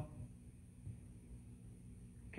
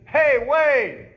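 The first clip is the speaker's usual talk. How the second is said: loud, high hail across a street; calling out; 11 semitones higher, 13 dB louder than usual. Retold or imitated. Someone hailing a passer-by, loud high call. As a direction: call to someone walking away.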